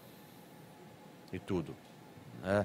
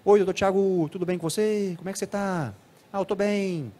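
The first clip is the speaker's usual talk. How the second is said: high singsong cartoon delivery, same timbre throughout; cartoon-like child voice; 7 semitones higher than usual. Shown as a demonstration. Lecturer imitates young patient greeting the doctor, cartoon-like voice. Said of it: "que fala igual desenho".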